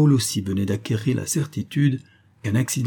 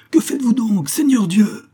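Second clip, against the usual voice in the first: high, loud, aghast exclamation; crying out; 12 semitones higher, 6 dB louder than usual. A narrator's reading. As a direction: shout it in alarm at your master. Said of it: "s'écria Olivain".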